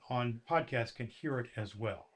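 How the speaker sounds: ordinary delivery, nothing marked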